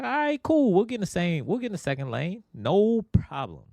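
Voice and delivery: put-on high, eager kid voice; voicing the kids. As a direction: play the kids agreeing cheerfully. Kids agreeing to the lane plan.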